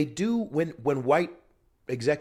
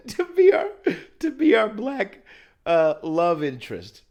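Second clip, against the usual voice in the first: high, loud words through laughter; laughing rant; laughing while ranting; 10 semitones higher, 8 dB louder than usual. Real, not acted. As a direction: giggle out the words.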